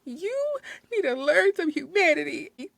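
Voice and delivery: high, wailing tearful rebuke; mock boo-hooing. Crying customer lecturing the staff, mock boo-hooing. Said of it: "like, boo-hooing".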